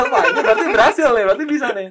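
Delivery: high, loud, laughing with delight; excited laughing. Retold or imitated. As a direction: burst out gleeful and giggling. Retold joy at success. (senang sambil tertawa)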